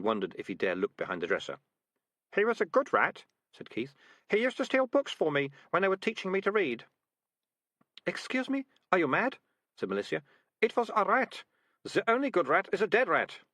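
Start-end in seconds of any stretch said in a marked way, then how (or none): 2.3-6.9 put-on higher, sad young man; a hint of a grieving boy; boy's voice. A narrator's reading. Keith mourns the rat. 8.0-13.4 put-on high, incredulous girl; a scornful girl, disbelieving; girl's voice. A narrator's reading. Malicia incredulous.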